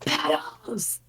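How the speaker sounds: airy unvoiced whisper; stage whisper. Real, not acted. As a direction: whisper it, breathy and audible. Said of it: "You got a stage whisper".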